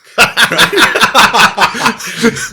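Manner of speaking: a real burst of high, loud laughter; big laugh. Real, not acted. Laughing hard while telling the podcast host. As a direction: laugh out loud at the absurd memory. Laughing at his own story.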